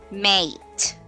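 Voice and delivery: "mate" said alone, long A held. Shown as a demonstration. Teacher gives an example word, mate with long A. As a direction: stretch the vowel in "mate".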